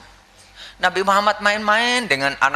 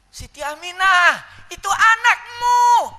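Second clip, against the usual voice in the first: high, slow, ringing shout; excited calling out; 14 semitones higher, 7 dB louder, slower than usual. Acted. Ummu Aiman telling mother about her baby, excited shout. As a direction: announce it loudly, stretching each phrase.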